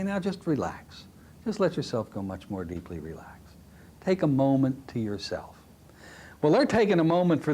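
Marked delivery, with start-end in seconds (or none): 0.0-5.6 deepening voice. Shown as a demonstration. Hypnotist deepening trance.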